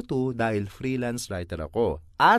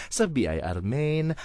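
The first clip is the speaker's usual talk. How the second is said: ordinary talk, nothing marked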